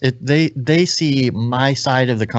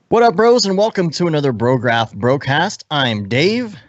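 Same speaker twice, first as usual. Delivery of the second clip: podcast intro; 3 semitones higher than usual. Acted. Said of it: "do this in one breath".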